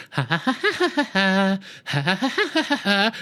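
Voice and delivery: mock villain giggle in bursts; imitated giggle. Reviewer imitating the giggle the doctor hears, put-on laughter. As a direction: act out a staccato, jumping cackle. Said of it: "the doctor hears".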